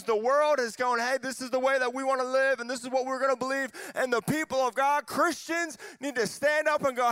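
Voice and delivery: fired-up preacher, pitch lifted; impassioned preaching. Real, not acted. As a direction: drive it home to a crowd with conviction. Preaches at full force.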